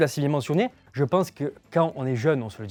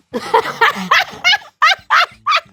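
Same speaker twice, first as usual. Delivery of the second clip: high burst of laughter; 10 dB louder than usual. Real, not acted. Bursts out laughing at a double meaning.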